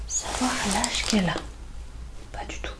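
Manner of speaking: usual speaking voice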